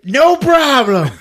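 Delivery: high, loud, drawn-out and laughing; hammed-up carefree maniac; Old Greg. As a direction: brush it off in a crazed sing-out. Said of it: "he's just like".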